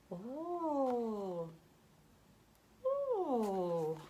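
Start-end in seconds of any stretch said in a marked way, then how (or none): none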